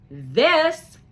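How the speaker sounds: high, loud reveal; triumphant reveal. Acted. Jerome showing Piper the money, loud and proud. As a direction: present it proudly.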